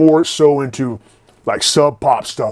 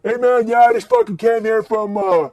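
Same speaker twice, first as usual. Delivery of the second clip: spaced-out punk; 9 semitones higher than usual. Retold or imitated. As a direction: play the burned-out California punk. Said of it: "He started talking".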